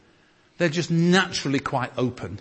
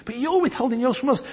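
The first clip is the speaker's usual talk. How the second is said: much higher, begging; pleading; 11 semitones higher than usual. Acted. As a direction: plead as if hurt they hold back. Paul pleading with the Corinthians.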